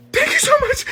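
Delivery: very high, strained imitated squeal; hammed-up high thank-you through laughter. Kid blurts thanks after being pinched.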